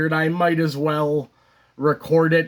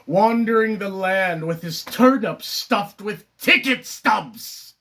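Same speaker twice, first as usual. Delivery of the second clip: high, mounting rant; agitated stage outburst; theatrical Moon monologue; 5 semitones higher than usual. Acted. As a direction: build to a punched, spat-out finish. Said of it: "the character Moon".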